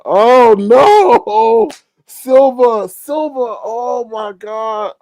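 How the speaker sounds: high, drawn-out cry of disbelief; shocked wailing. Real, not acted. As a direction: wail it high, starting loud and trailing off. Reacts to silver price jump.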